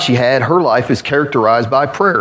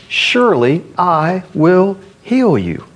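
slow, raised, ringing assurance; emphatic promise; 5 semitones higher, slower than usual. Acted. Preacher repeats God's promise to the congregation, emphatic and raised. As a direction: proclaim it slowly on a higher pitch.

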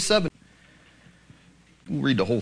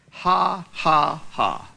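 mocking laugh; much slower than usual. Tobiah's mocking laugh acted.

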